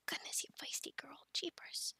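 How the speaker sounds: very soft, exasperated mumble; hushed, half-voiced tutting; grumbling aside. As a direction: fuss under your breath at a naughty pet. Grumbling after scolding the dragon.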